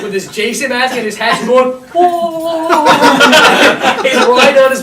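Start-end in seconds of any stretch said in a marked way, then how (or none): 1.9-2.8 long, high mock wail; hooting cry of a rider; wailing whoa. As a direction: let out a drawn-out, high whoa. Retold wail while riding bike.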